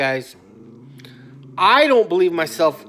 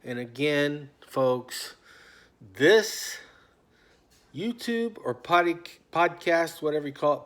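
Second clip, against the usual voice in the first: usual speaking voice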